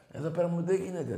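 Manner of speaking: usual speaking voice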